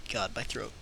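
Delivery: normal talking voice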